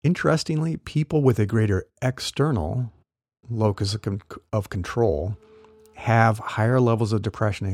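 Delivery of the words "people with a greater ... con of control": ordinary delivery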